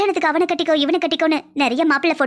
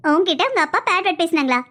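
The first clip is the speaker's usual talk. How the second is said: normal talking voice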